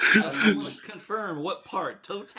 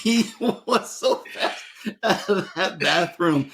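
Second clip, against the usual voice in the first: high-pitched, laughing storytelling; words breaking into laughter; 5 semitones higher, slower than usual. Real, not acted. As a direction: crack up while telling it. Cracking up over his prank story.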